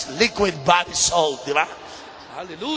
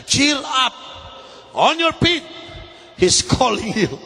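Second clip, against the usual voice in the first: high, projected preacher's call; rally the blind man to rise; rousing call; 7 semitones higher, slower than usual. Acted. Quoting crowd calling the blind man. (masiglang panawagan, mataas ang boses)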